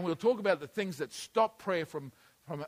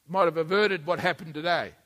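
ordinary talk, nothing marked

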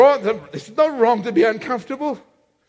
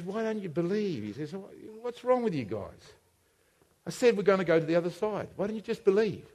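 soft, low reproach; hushed, disappointed questioning; quiet chiding; 5 semitones lower, 12 dB quieter than usual. Acted. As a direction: gently question them, barely raising the voice. Acts Jesus gently chiding disciples.

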